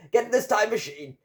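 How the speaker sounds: high-pitched, frantic order; urgent command. A narrator's reading. Cloaked man orders seven men aboard, urgently commanding.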